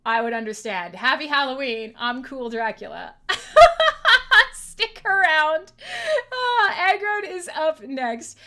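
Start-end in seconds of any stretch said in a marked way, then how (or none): 3.2-4.5 high loud helpless laugh; burst of laughter. Real, not acted. Cool Dracula filter on himself. 4.5-8.4 slow words broken by helpless laughter; laughing through words. Real, not acted. Laughing through filter outro.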